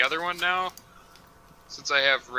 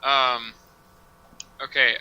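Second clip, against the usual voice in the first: ordinary talk, nothing marked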